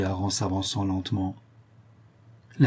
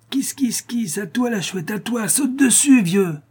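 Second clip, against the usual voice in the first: put-on very high, shrieky boy's voice; high-pitched, excited urging; gleeful egging-on; 12 semitones higher than usual. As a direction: sic him on her like a dog. Boy sets blind man on old woman.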